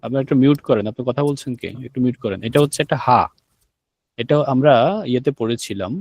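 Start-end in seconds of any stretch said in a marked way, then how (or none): none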